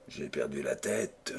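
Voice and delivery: murmured. A narrator's reading. Nantas excusing himself to the baron, murmured low. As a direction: drop almost to a murmur. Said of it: "murmura-t-il".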